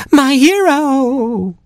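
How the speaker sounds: very high, long drawn-out coo. Acted. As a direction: swoon it slowly in a high girlish voice.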